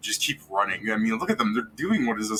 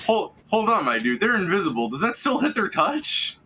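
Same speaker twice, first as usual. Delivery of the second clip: excited protest; 8 semitones higher than usual. Real, not acted. Rules dispute, raised voice.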